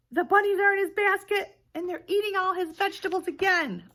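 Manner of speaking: storyteller exclaiming surprise; excited. A narrator's reading. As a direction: tell it with thrilled alarm. Discovering bunnies in basket.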